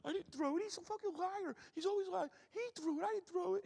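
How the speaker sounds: big lineman whining and sobbing his innocence; mock crying. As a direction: blubber out the denial like a tattling kid. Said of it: "He starts crying to me".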